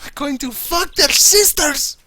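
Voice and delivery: high, hammed-up Steinbrenner quote; mock Steinbrenner squeal; Steinbrenner line again. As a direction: squeal the line like the deranged owner. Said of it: "he calls himself Steinbrenner".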